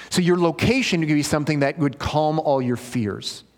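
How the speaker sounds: ordinary talk, nothing marked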